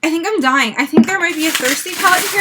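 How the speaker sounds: ordinary talk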